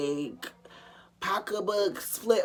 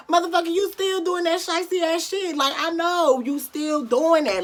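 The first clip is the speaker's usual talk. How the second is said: high, loud angry inner voice; imitating her own fury; indignant; 9 semitones higher, 3 dB louder than usual. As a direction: call him out. Mad inner voice at the ex.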